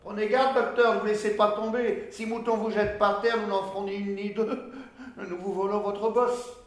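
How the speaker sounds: high, loud teasing; mocking taunt. Washerwoman warning the doctor about his hump, loudly mocking. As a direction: tease him loudly with a mock warning.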